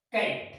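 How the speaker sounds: कैट with a hard k sound; कैट spoken as a teaching example, c read as k; demo word cat. Shown as a demonstration. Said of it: "क्या बोलेंगे इसको".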